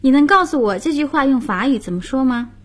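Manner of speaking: usual speaking voice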